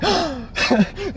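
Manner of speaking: sharp high gasp of shock. Retold or imitated. [name] reacting to the friend's warning with a gasp. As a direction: suck in air, startled. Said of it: "And I was like".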